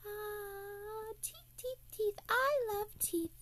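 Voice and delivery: high, breathy greedy fairy; tooth fairy voice. Acted. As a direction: coo over the teeth like treasure.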